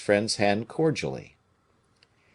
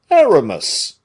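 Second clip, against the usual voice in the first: much louder, higher call; protesting cry; 9 semitones higher, 11 dB louder than usual. A narrator's reading. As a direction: cry his name in protest. Porthos protests.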